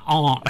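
"aunt" with an open ah; aunt as ahnt. Shown as a demonstration. Co-host humours the host, "aunt" said as AHNT. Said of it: "Well, to satisfy you".

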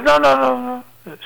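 high teasing no no no; lightly voiced old lady refusing playfully; old woman voice. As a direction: wag a finger at him in a high voice. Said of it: "And she says".

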